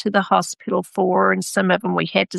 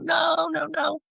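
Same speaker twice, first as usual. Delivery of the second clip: high mock wail of no; imagined child's bawling; 9 semitones higher, 5 dB quieter than usual. Mother imagining her daughter's reaction, a mock high wail. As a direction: cry it out like a kid about to bawl. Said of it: "expecting her to start bawling and crying".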